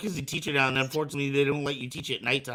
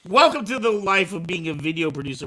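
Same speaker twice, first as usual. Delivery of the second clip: ordinary talk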